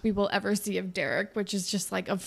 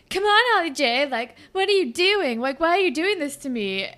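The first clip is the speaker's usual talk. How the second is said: much higher, louder whining plea; whiny bratty teen; 8 semitones higher, 7 dB louder than usual. Retold or imitated. Allie confronting Holly J, high whiny mock plea. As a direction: mimic a self-pitying brat.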